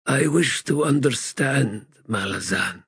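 lightly voiced, weary, bitter god; a hint of a broken ancient god; frail god voice. Crippled god speaks.

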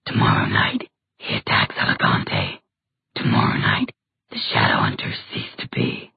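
deep, breathy demon whisper. Acted. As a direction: whisper the doom like a monster.

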